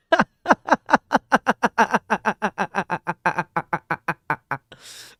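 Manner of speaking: long high cackle; villain laugh. Acted. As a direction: cackle like a supervillain. Villain laugh bit on stream.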